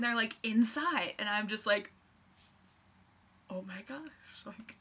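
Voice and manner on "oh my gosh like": whisper. Retold or imitated. Seeing friends arrive, excited hush.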